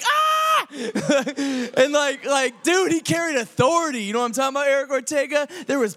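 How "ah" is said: high, loud mock scream of fright; hyenas' mock scream. Hyenas hearing Mufasa roar, hammed-up scream. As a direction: scream like a startled cartoon hyena. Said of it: "he roars, and they're like".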